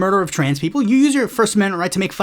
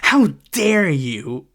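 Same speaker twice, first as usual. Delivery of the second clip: mock outrage; 3 semitones lower, much slower than usual. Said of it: "But they were like".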